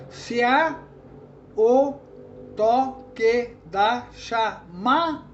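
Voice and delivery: very slow, every syllable separate, "C A" as one beat. Shown as a demonstration. Teacher counting seven poetic syllables, syllable by syllable. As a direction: count out "toque da chama" with gaps, ending on "ma". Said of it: "contar essas duas como uma sílaba só".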